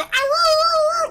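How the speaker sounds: long, high howl; sustained high-pitched hound howl; dog howl. Acted. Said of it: "See, he howled".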